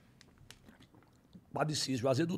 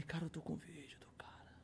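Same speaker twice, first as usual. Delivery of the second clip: hushed, voiceless whisper; awed retold whisper; secretive whisper; 17 dB quieter than usual. Confides a secret prize, retold.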